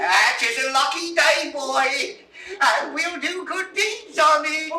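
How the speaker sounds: triumphant cry of joy; joyful shout. Acted. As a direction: shout your good luck to the sky. Shepherd rejoicing at his fortune.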